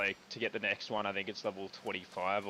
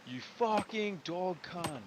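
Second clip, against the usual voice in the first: angry; 7 semitones higher, slower than usual. Real, not acted. Raging at game enemy.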